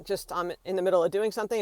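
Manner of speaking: normal talking voice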